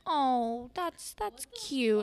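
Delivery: slow, high-pitched mock pity. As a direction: coo it with condescending sympathy, imitating. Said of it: "the pitying voice".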